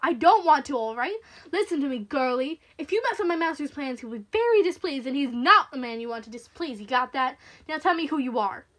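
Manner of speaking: very loud, bullying tirade; menacing henchman laying down the law; angry threat. Acted. Caller threatens her angrily.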